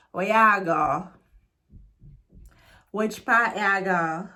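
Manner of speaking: Jamaican patois. Shown as a demonstration. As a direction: give it the patois attitude. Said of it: "The third one".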